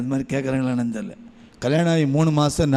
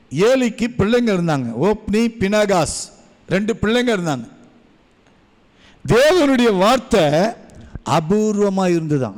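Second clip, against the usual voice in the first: higher, louder preaching voice; 7 semitones higher, 4 dB louder than usual. Acted. Preacher to the congregation, rising loud preaching. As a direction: build the sermon up with force. Sermon rising to full force.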